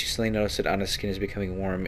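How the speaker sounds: normal talking voice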